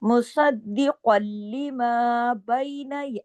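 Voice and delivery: slow melodic tajweed chant; slow Quranic recitation with held vowels. Shown as a demonstration. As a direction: recite the verse slowly, stretching each vowel. Recites verse slowly.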